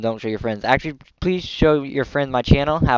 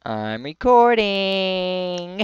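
slow, higher drawn-out teasing; mock announcing; 5 semitones higher, much slower than usual. Teasing his friend Jack, slow singsong. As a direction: stretch out recording to tease. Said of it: "teasing Jack about recording".

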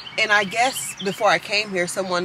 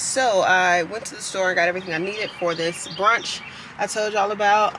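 ordinary talk

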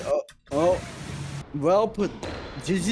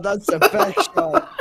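laughing; 12 semitones higher, 4 dB louder, slower than usual. Real, not acted. Hyped over a hit.

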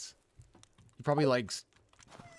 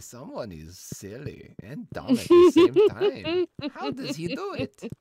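character voice; 11 dB louder than usual. Acted. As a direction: ham up a goofy character. Voicing game character.

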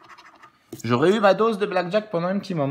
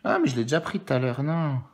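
ordinary talk, nothing marked

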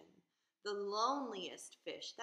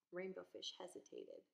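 ordinary delivery, nothing marked

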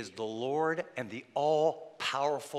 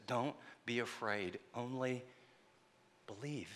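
calm him quietly; gentle reassurance; 4 semitones lower, 9 dB quieter than usual. Retold or imitated. Jesus comforts the grieving father.

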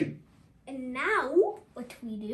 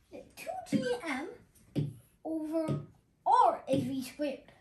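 usual speaking voice

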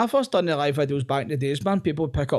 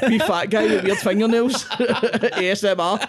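louder, giggling self-mockery; laughing through words; 6 semitones higher, 5 dB louder, slower than usual. Real, not acted. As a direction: talk while laughing at yourself. Joking about himself, still laughing.

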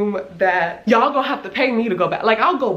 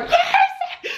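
squeaky laughing cry of Mercy; squealed mercy. Retold or imitated. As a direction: squeal it high through a laugh. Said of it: "I was like".

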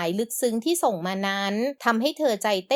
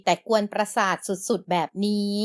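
usual speaking voice

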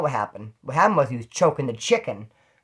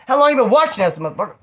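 loud, higher angry demand; angry confrontation; 5 semitones higher, 7 dB louder than usual. Retold or imitated. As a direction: call out the peeping neighbor, furious. Confronting the peeping neighbor.

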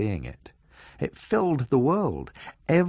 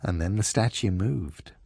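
ordinary delivery, nothing marked

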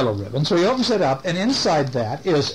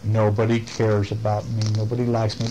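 lower, understated self-pity; mopey Sammy; 5 semitones lower than usual. Acted. Storyteller recalls Sammy feeling unloved, mildly glum. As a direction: mope it lightly, pitched down. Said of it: "he said".

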